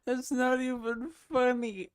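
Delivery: giggling through the denial; laughing. Real, not acted. Streamer to chat, cracking up while denying it. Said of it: "makes me laugh so much".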